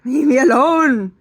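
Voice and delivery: high, loud exasperated snap; mock angry outburst; snapping. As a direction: snap at her to back off. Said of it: "I didn't say that".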